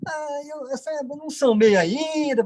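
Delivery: high, hesitant, sheepish mumbled excuse; embarrassed stammer. Retold or imitated. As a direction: act out dodging an embarrassing answer. Embarrassed answer about his poor sales. (gaguejando, sem graça, em voz aguda)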